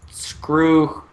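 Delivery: normal talking voice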